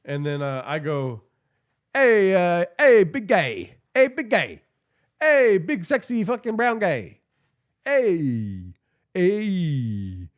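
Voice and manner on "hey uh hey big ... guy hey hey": flirty come-on. Retold or imitated. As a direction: coo at him like you're hitting on him. Flirting with big guy in elevator.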